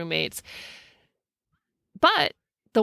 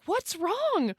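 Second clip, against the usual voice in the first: worried; 9 semitones higher, 5 dB quieter than usual. Retold or imitated. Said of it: "she's like".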